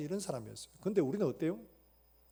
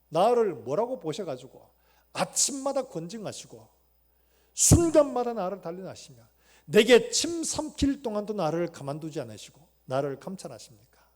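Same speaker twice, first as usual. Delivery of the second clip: anguished lament; 2 semitones higher, 10 dB louder than usual. Retold or imitated. Job complaining to God, loud anguished lament. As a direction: plead with God bitterly. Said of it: "탄식하면서 고백하는 것들이".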